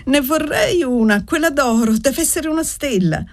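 put-on very high, small child voice; child's wishful cry. A narrator's reading. Little girl wanting the golden spark, high wishful voice. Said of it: "gridò chinando la fronte enorme".

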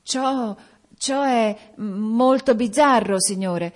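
high, halting, repeated first word; falter, then push the words out slowly; frightened stammer. A narrator's reading. Terrified gravedigger answers the spirit, stammering. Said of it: "replicò il Beccamorti tramortito dalla paura".

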